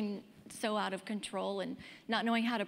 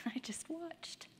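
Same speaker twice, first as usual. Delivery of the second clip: whisper; 6 semitones higher, 10 dB quieter, slower than usual. Real, not acted. Stunned, watching mother collapse.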